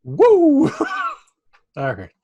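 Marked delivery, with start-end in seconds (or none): 0.0-0.7 excited whoop. Real, not acted. Cheering after the Canadian bit.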